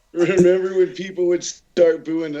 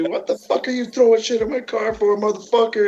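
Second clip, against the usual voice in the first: imitating a yelling woman; 6 semitones higher than usual. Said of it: "starts yelling at K J".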